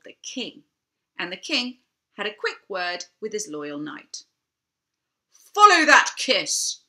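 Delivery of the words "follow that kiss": squawked, loud and high, slowly; squawked order. Acted. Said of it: "he squawked".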